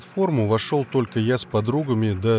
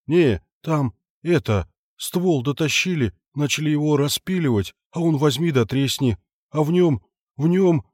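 rising, breathless panic; panicked babbling; 4 semitones higher than usual. Acted. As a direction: stammer it out, more scared each word. Foreman stammers out in panic.

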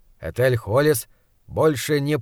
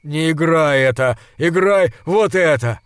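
put-on insistent drunk demand; 3 semitones higher, 6 dB louder than usual. A narrator's reading. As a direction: push the pianist around. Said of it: "сказал он".

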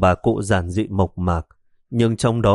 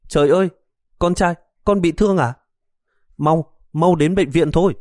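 mother shrieking much higher in alarm; alarmed cry; 8 semitones higher than usual. Acted. Mother sees her son's injured face, alarmed high cry. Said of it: "la lên".

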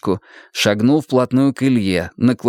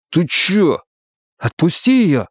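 angry demand; 6 semitones higher, slower than usual. Acted. Ilya pulls Vera free.

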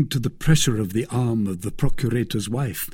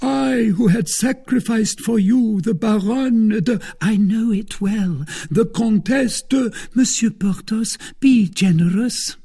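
a wounded noble's raised complaint; Porthos voiced high and loud; 11 semitones higher, 6 dB louder than usual. A narrator's reading. Porthos reproaching the wife, loud and high. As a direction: lay the guilt on thick, pitched high.

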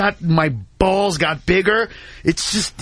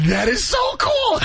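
much higher and slow, giddy delight; thrilled squeal; slower than usual. Shown as a demonstration. The guilty-pleasure half of [name] reacting, high slow giddy squeal. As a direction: stretch out the thrill like a kid. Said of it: "part of me was like".

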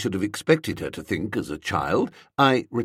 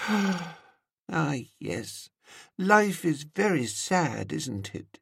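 slow, soft-ending melancholy sigh of a voice; mournful dreamy woman; 8 semitones higher, 5 dB quieter, much slower than usual. A narrator's reading. Woman lamenting life after a sigh, mournful. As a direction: sigh the words out sadly.

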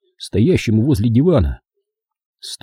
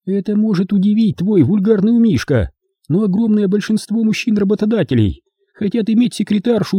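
high, emphatic female lecturing voice; indignant woman character; 11 semitones higher than usual. Acted. Hilda explains why bosses hire her, high indignant woman's voice. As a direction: lecture him as an offended woman, pitched high.

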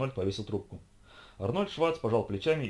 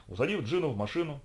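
usual speaking voice

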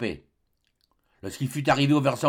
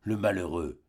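low, soft mournful sigh; doctor murmuring in grief; grieved murmur; 8 semitones lower, 6 dB quieter than usual. Acted. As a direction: mourn the brave boy. Doctor after Joe's sacrifice.

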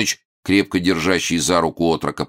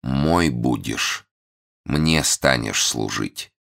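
heartfelt tone; much slower than usual. Acted. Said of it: "перешёл на задушевный тон".

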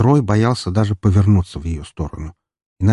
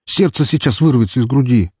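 out of breath; 4 semitones higher than usual. Acted. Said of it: "тяжело дыша".

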